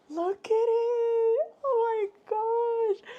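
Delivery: very high, slow, tearful wail; happy crying. Real, not acted. Vlogger holding up the gift, crying happily. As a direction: stretch it out, crying with joy.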